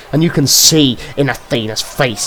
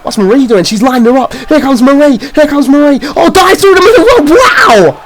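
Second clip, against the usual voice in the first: rising excitement; 9 semitones higher than usual. Real, not acted. Commentator building up to Marie's dive, excited.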